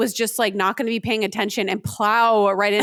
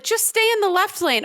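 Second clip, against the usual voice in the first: bossy friend's instruction; 10 semitones higher than usual. Retold or imitated. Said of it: "one of you guys was like".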